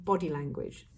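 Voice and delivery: normal talking voice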